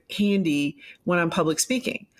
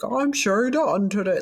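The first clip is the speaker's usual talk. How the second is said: higher-pitched, put-on newsreader persona; fake anchor voice; 4 semitones higher than usual. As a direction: parody a phony TV anchor introduction. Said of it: "I don't talk like that".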